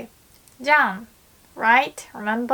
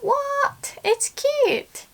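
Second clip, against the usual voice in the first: high, incredulous What; surprised protest; much slower than usual. Pretend. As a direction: blurt it out in disbelief. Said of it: "and I wanna say".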